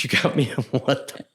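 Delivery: can't believe the gift; laughing disbelief. Retold or imitated. Husband retelling wife's laughing disbelief at the charm.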